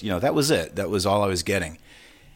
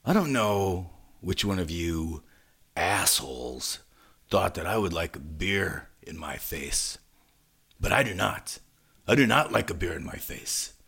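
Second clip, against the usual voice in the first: retold menacing address; 3 dB quieter than usual. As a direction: draw out the mock threat, keeping it restrained. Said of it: "picks up the mic and he goes".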